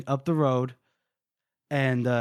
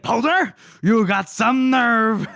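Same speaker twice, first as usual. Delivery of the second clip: mock angry general; 6 semitones higher, 4 dB louder, slower than usual. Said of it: "Croy's like".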